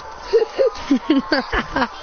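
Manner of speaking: high, loud crazed laugh-cry; laughing while crying. Acted. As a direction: play tears mixed into mad laughter. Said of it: "crying at the same time".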